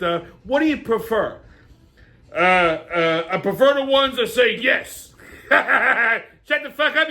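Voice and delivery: brash comic family banter; hammed-up sketch characters. Characters bicker over the host's questions in high put-on voices.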